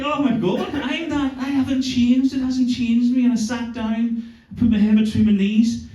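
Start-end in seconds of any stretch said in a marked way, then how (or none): 0.0-3.2 higher, dismayed inner voice; a raised, appalled aside; dismayed realisation. Retold or imitated. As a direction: realise in horror you haven't changed. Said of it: "I was like".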